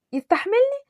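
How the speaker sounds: high, rising incredulous echo; affronted question; indignant disbelief. Acted. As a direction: repeat it offended, pitch shooting up. Indignant echo of insult about weight.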